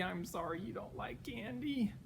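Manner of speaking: usual speaking voice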